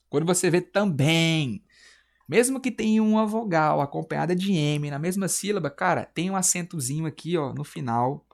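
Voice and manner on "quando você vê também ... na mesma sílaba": ordinary talk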